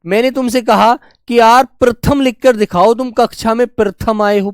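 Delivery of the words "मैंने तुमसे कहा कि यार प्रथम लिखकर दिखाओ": ordinary delivery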